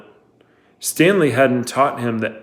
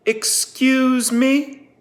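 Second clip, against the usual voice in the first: incredulous excuse me; 13 semitones higher, much slower than usual. A narrator's reading. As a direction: stretch it out, daring them to repeat. Warden's sharp incredulous challenge.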